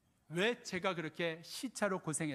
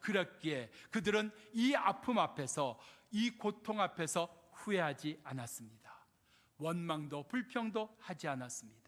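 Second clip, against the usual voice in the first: normal talking voice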